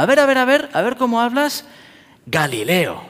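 taunting challenge. Retold or imitated. Mocking Peter's accent.

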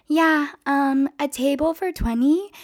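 the late group's leader asking for a table, high upward lilt; customer asking. Retold or imitated. Walk-in customer to restaurant staff before closing, high-voiced asking. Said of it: "forty-something year olds come in".

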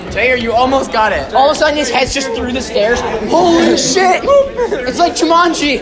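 loud excited laughing yells; hyped laughing. Real, not acted. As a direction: hype it up with laughter. Friend clips through wall.

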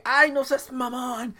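high, dragged-out whine of disbelief; mock disbelief. Telling how the landlady turned out Japanese, mock disbelief. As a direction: stretch it out, high and incredulous.

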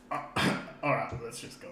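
normal talking voice

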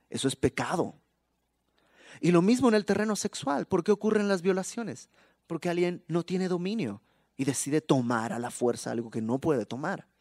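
normal talking voice

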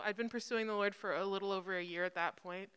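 normal talking voice

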